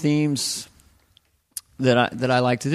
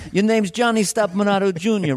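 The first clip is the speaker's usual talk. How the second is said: insistent New York father, pitched up; New York actor dad; 7 semitones higher than usual. Acted. New York actor correcting his son's speech, insistent. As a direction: drill it in with New York accent. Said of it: "a thing about a New York actor".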